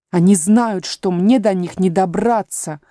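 plain reading voice

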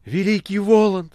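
high, loud acclaim; exultant echoing. A narrator's reading. Margarita echoes the Master praising Woland, exultantly. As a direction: join in the praise fervently. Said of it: "стала вторить ему Маргарита".

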